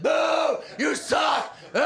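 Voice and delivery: high, loud mock heckling; imitated crowd jeers at full shout; booing crowd. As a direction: boo like a whole hostile audience. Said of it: "They booed me".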